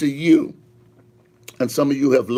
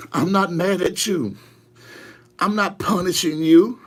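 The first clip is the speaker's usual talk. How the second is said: measured declarations with long pauses; raised-pitch pulpit proclamation; prophetic preaching; 5 semitones higher than usual. Acted. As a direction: deliver God's promise phrase by phrase. Delivers God's message in pauses.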